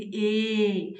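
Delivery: ए voiced with force from throat and palate; forceful vowel. Shown as a demonstration. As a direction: push ए out with effort. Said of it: "जोर लगाना पड़ता है इनको बोलने के लिए". (ए को ज़ोर लगाकर कंठ और तालु से बोलना)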